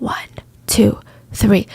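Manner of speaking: hushed count with spaced beats; retold whisper, counting out one by one; whispered countdown. Police counting before they slam the wardrobe open, whispered.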